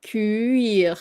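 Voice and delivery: Cuire split into two syllables, cu-ire. Shown as a demonstration. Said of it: "think of it as two syllable".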